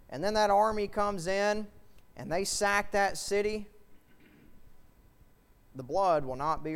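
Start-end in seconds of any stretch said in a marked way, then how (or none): none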